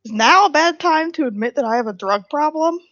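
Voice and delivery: ordinary delivery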